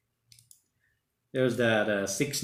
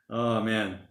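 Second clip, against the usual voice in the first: ordinary talk, nothing marked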